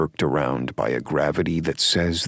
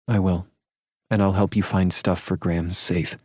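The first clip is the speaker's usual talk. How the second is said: higher, breezy woman; light and cheerful, pitched up; female character voice; 7 semitones higher than usual. A narrator's reading. Heroine's dialogue.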